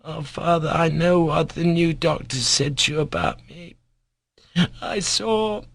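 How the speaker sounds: put-on high, frail child's voice; sick boy, sorrowful. A narrator's reading. Sick boy tells his father he knows, sorrowfully. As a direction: plead gently with father, knowingly.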